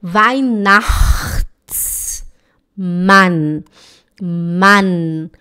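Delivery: "Weihnachtsmann" very slowly, each part separated; slow syllable drill. Shown as a demonstration. Teacher breaks the word down for students, very slow "Weihnachtsmann". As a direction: stretch "Weihnachtsmann" with long ch and pauses. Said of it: "falar o C H".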